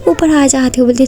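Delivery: ordinary delivery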